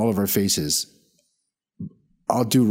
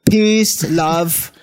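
loud, high caricature dating-app voice; Mike's imagined voice; 8 semitones higher, 5 dB louder, slower than usual. Pretend. Podcaster mocking his friend's dating profile, hammed-up caricature voice.

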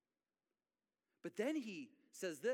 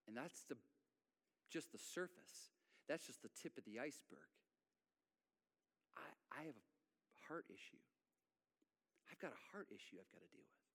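hushed confession; 7 semitones lower, 12 dB quieter than usual. Acted. Quiet confession of a heart issue.

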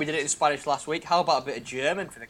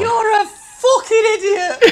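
high shouted line, breaking up; laughing; 10 dB louder, slower than usual. Real, not acted. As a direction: yell it while losing it laughing. Cracking up at the attempt.

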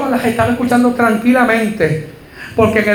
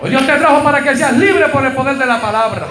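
rising, shouted sermon over music, higher; impassioned preacher's climax, much higher and shouting; full preaching; 5 semitones higher than usual. Acted. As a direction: drive the congregation to release, calling out.

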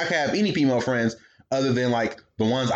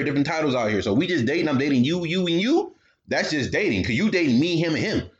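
ordinary talk, nothing marked

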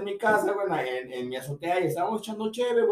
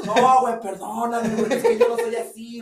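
mock drunk apology; 5 semitones higher, 6 dB louder than usual. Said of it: "a los quince minutos".

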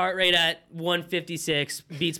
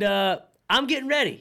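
normal talking voice

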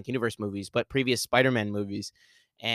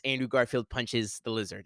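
usual speaking voice

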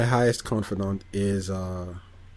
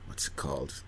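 whisper; 6 semitones lower, 11 dB quieter than usual. Real, not acted. Trying to remember a name.

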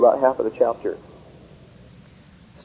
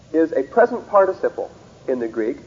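ordinary delivery

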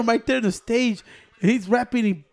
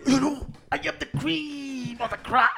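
Booker T impression; 4 semitones higher than usual. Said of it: "this stupid motherfucker was in a Royal Rumble".

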